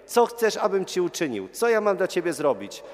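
high, loud, insistent questioning; emphatic question. Retold or imitated. Jesus asks the beggar what he wants, loud and emphatic. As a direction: demand an answer, as Jesus.

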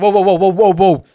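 calling whoa. Retold or imitated. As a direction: hold them back with a string of whoas.

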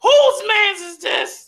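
very high, slow, loud holler; catchphrase shout. Shown as a demonstration. Cash repeating the catchphrase attempt, drawn-out high shout. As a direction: stretch it into a high imitation yell. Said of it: "you wanna give it a shot".

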